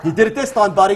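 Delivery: captain's retort. Retold or imitated. Captain telling him he did it this time, mock gruff. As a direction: lightly voice the captain's scolding. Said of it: "He goes".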